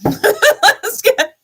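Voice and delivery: loud, high laugh through the words; bursting laugh. Real, not acted. Laughing it off as she turns to the tarot. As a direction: break into laughter as you start.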